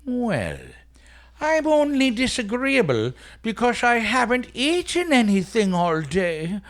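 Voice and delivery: put-on Irish accent. Stingy Jack sweet-talks the devil in a hammed-up Irish accent. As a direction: wheedle like a sly stage Irishman. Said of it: "I'm gonna play Stingy Jack with my best, uh, possible Irish accent".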